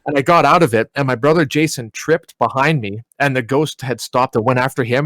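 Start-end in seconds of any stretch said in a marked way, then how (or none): none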